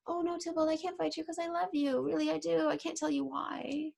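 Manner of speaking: mock Romeo as a big baby; whiny pleading, imitating Romeo. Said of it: "he's being a big baby".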